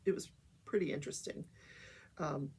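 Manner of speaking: ordinary talk, nothing marked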